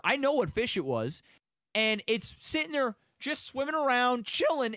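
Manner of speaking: ordinary talk, nothing marked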